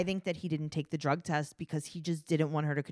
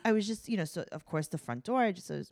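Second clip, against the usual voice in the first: ordinary talk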